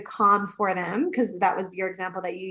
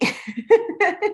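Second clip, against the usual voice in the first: high laugh; 11 semitones higher, 3 dB louder than usual. Real, not acted. Laughing at firecracker joke.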